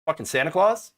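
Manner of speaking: ordinary talk, nothing marked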